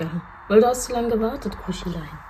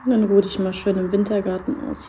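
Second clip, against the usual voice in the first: ordinary talk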